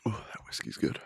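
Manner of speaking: hushed aside about the whiskey; whisper. Real, not acted. Host whispering an aside about his drink, hushed whisper. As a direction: murmur it just under breath.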